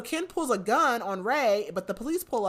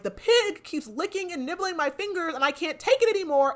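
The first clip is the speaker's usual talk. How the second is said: exasperated complaint; 9 semitones higher than usual. Retold or imitated. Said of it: "And he's like".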